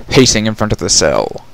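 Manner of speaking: ordinary delivery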